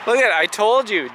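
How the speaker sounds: ordinary talk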